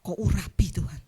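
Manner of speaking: hushed, breathy murmured prayer for healing; preacher mimics a whispered prayer for healing. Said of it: "Paling saya doakan".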